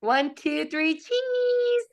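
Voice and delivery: cheese sung out high; photo countdown. Real, not acted.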